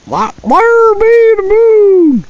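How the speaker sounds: tiny high-pitched cartoon voice; high squeaky voice. Squeaky outburst at the moon.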